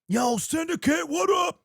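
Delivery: high, shouted happy hello; excited fan greeting. Retold or imitated. Fan in San Francisco greeting Syndicate, high excited shout. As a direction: yell it like a thrilled fan spotting him. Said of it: "He was like".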